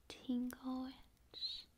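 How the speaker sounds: ordinary talk, nothing marked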